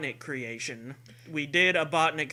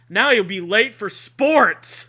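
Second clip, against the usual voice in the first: loud, high spooky promo read; hammed-up blurb; 6 semitones higher, 11 dB louder than usual. Host reads the back cover as a hammed-up promo. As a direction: oversell it like a cheesy promo.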